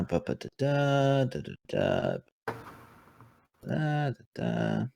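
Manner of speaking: soft sing-song placeholder syllables; idly da-da to yourself while searching notes; absent-minded scatting. Real, not acted. Filling time while searching notes.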